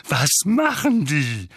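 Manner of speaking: slow, irate question; Onkel Vernon, furious. A narrator's reading. Uncle asks what Dementors do, furious. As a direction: demand it slowly and crossly. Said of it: "fragte Onkel Vernon fuchsig".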